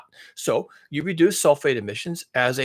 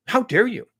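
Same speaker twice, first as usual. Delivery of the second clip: mock outrage; 6 semitones higher, 3 dB louder than usual. Acted scolding of the Chinese.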